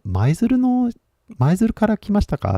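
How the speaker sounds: high-pitched tentative question; polite inquiry. Retold or imitated. Asking two men in a cafe, politely high. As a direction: lift the voice high to ask a stranger. Retells asking strangers where they're from.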